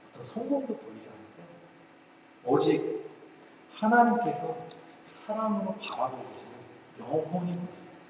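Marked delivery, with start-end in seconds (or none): none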